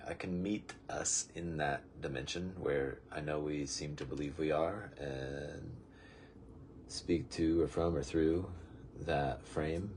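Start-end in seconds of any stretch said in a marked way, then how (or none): none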